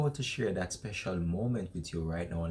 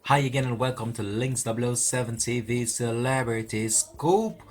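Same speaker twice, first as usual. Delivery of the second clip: loud, punchy celebrity-show promo read; show announcer intro; 2 semitones higher, 4 dB louder than usual. Acted. Host welcomes viewers to a celebrity scoop show, announcer voice. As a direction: project it like a TV announcer opening the show.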